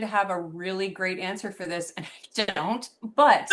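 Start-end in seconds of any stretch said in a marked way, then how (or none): 3.2-3.5 laughing it off, embarrassed. Real, not acted. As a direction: breaks into a laugh. Laughs, admitting she lacks an answer.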